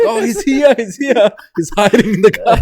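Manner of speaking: high, loud teasing shout; calling out. Retold or imitated. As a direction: shout it out through laughter, imitating rowdy friends. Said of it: "They start calling out her name".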